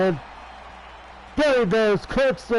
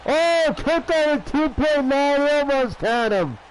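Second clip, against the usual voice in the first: high, excited call of a near-fall; 7 semitones higher than usual. Real, not acted. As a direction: raise your voice for the kick-out.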